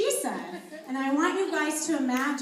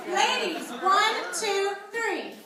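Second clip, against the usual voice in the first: loud, pitched-up coaching call; loud shouting; 6 semitones higher, 7 dB louder, much slower than usual. Real, not acted. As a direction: call it out to the room. Coach shouting cues to group.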